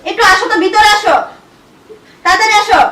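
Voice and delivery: loud, urgent summons; loud angry calling. Acted. Wife summoning husband inside at once, loud and angry. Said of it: "এত জোরে জোরে ডাকতেছ, রাগ মনে হয়".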